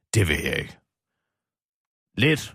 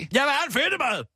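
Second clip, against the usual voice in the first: high, insistent cry about the fedtemad; exasperated outburst; 11 semitones higher than usual. Acted. [name] insists to her co-host she wants a fedtemad. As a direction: demand it like a stubborn child.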